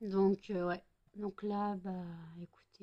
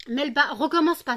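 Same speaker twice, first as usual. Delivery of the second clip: sharp loud scold at the cat; scolding shout; 7 semitones higher, 11 dB louder than usual. Real, not acted. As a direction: tell the cat off loudly. Scolds cat scratching eye. (réprimande criée au chat)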